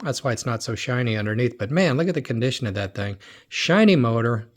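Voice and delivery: normal talking voice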